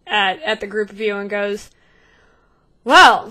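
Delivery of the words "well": a loud drawn-out well; loud greeting. Acted. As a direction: call it out to the group. Captain greets returning group.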